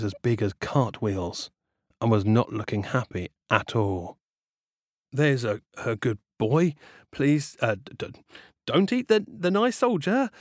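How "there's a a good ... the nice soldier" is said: nervous. Acted. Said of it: "he said".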